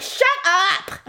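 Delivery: mock exasperation. Wife retorts to her grumbling husband, mock exasperated. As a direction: cut him off in playful exasperation.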